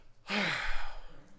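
long, heavy exhaled sigh. Shown as a demonstration. Preacher showing congregation a contemptuous reply, long sigh. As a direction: let out a long, demonstrative sigh. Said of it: "or with the long".

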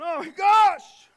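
loud, slow, stunned outcry; drawn-out shout of alarm; shocked exclamation. Retold or imitated. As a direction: cry it out in disbelief. Crowd's shocked exclamation voiced.

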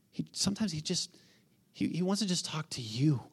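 hushed, intimate sermon whisper. Real, not acted. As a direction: lean in and breathe it to the congregation.

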